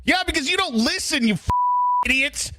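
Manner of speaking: high, strained exasperated jab. Real, not acted. Streamer exasperated with Lauren, high and strained. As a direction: snap at him in disbelief. Exasperated insult, pitch jump.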